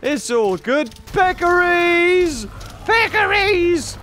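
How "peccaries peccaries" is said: high, slow delighted cry; drawn-out excited call; gleeful call. Real, not acted. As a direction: sing out at the animals. Calling out happily at animals.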